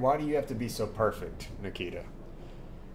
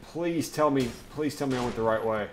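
usual speaking voice

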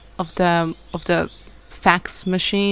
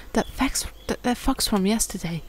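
whispering; 9 dB quieter than usual. Real, not acted. Nervous discovery.

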